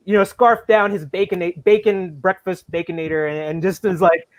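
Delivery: usual speaking voice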